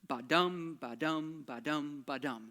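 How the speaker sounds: BA-DUM with the second syllable stressed; iambic beat demo. Shown as a demonstration. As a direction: chant ba-dum evenly, weight on DUM. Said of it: "it goes kind of like this".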